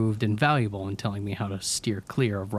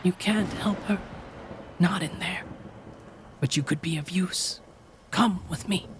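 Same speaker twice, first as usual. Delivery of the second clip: Cheryl pleading; 9 semitones higher than usual. A narrator's reading.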